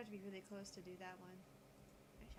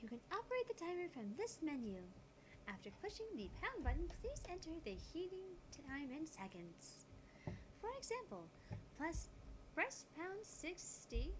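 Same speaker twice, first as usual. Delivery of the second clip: put-on high, bright girlish character read; cheerful Mayuri; 9 semitones higher, 5 dB louder, slower than usual. Player voicing Mayuri explaining the timer, high and chirpy. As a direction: explain the microwave like a sweet helpful girl. Reads Mayuri's dialogue aloud.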